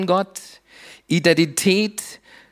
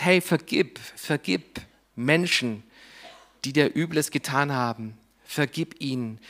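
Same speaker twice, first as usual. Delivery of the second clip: softer, tender voice of Jesus; gentle plea to forgive; 3 semitones lower, 6 dB quieter than usual. Acted. Preacher voicing Jesus asking the listener to forgive, soft and tender. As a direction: coax them quietly toward forgiving.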